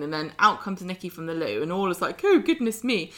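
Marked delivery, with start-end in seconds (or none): 2.2-3.1 higher, louder flustered "oh!"; mock embarrassment. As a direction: act out being caught red-faced. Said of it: "and embarrassedly makes a retreat".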